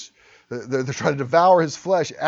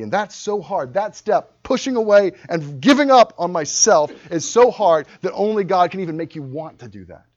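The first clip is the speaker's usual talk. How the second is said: ordinary talk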